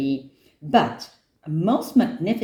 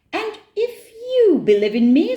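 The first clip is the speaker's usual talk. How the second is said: put-on high, loud child voice; very high-pitched little-girl voice; eager child; 6 dB louder than usual. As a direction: propose it eagerly, pitched right up. Nora makes the tiger an offer.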